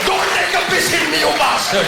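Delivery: put-on shrill high Indian-accented yell; Indian heckler. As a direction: scream it like an angry Indian uncle. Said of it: "get their insults the wrong way around".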